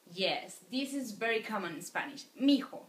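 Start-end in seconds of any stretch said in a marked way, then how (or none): 2.4-2.8 Mijo as one word, mi-jo, not mi hijo; contracted form. Shown as a demonstration. Teacher to students, modeling the contraction Mijo. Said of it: "No mi hijo, but mijo".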